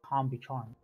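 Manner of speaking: ordinary talk, nothing marked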